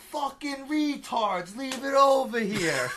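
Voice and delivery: high, slow mock-angry kid voice; imitating a cursing little boy. Uncle quoting his young nephew in the backyard, mock-angry kid voice.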